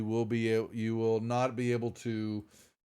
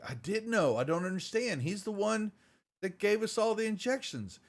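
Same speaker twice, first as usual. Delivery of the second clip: bewildered Flynn; 10 semitones higher than usual. Acted. Flynn answers Reed, bewildered.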